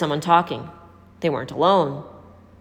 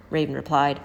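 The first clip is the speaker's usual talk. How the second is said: ordinary delivery, nothing marked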